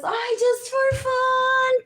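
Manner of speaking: high, slow, sugary mock plea; sing-song high mock request; mock sweet ask. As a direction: coo it high and slow like a fake favor. Mocking what a friend would say.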